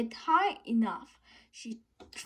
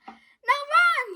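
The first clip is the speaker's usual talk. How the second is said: hissed; 8 dB louder than usual. A narrator's reading. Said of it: "he hissed".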